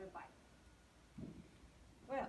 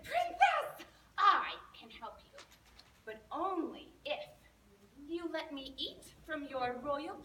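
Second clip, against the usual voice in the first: frog voice; 2 semitones higher, 7 dB louder than usual. Acted. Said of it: "the frog said".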